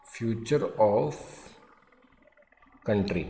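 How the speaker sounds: "future of" slowly, pause, then "country" falling; dictation in chunks. Shown as a demonstration. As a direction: break it up for writing. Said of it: "क्या लिखेंगे". (रुक रुक कर लिखवाना)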